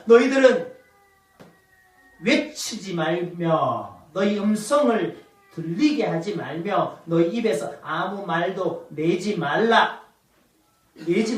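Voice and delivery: low, slow, weighty order; solemn command. Acted. Joshua ordering the people to silence, solemn command.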